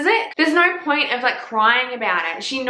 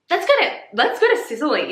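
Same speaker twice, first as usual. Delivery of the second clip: eager excitement; 6 semitones higher, faster than usual. Real, not acted. Excited travel wish.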